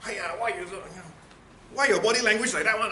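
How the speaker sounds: high, loud scolding mom; nagging mother's voice. Shown as a demonstration. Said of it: "mother's voice or something".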